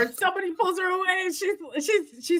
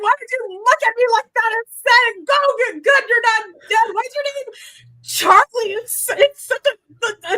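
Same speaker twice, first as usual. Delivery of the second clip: frantic shouting; 5 semitones higher than usual. Acted. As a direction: shake him and demand answers. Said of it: "she goes back to shaking this guy".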